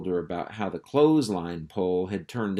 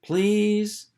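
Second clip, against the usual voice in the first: put-on much higher pleading child; a little pup pleading; begging pup; 12 semitones higher, 4 dB louder than usual.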